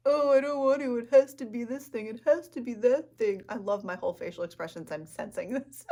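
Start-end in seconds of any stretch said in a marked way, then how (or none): 0.1-3.4 louder, higher put-on protest; hammed-up complaining, pushed loud; mock reluctance. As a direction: play up the fussy inner perfectionist. Acting out reluctant perfectionist inner voice.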